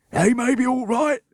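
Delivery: high, loud, squashed gasp; gasping Bert. Acted. Bert crushed under children, gasping. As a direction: wheeze it from under the pile. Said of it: "gasped Bert".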